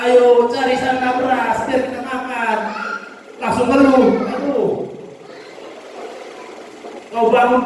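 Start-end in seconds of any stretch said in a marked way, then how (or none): 0.1-2.6 mock grumble pitched higher, slow; a nagging morning gripe; grumbling. Spouse nagging to go find rice, mock high grumble. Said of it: "Jangan bangun pagi".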